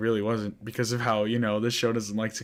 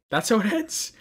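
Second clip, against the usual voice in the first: much higher, louder, rising indignation; outraged disbelief; 12 semitones higher, 6 dB louder than usual. Real, not acted. Vlogger outraged at the anime's ending, high indignant disbelief. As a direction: cry it out in disbelief.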